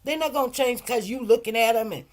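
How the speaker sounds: ordinary delivery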